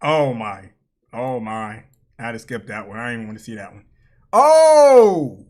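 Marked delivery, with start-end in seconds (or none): 4.3-5.5 long, loud cry of shock; shocked shout. Real, not acted. Reacting to a clip he's watching, shocked shout. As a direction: shout it out, drawn out and falling.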